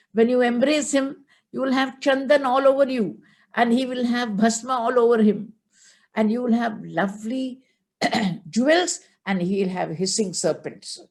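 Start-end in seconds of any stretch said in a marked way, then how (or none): 0.1-9.0 higher, scornful put-down; disguised Shiva mocking himself to Parvati, higher and scornful. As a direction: sneer at the beggar god. Brahmin boy mocking Shiva.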